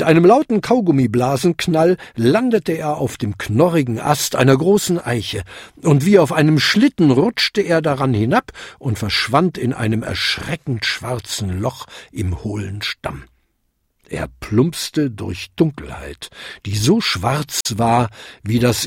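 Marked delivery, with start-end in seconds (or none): none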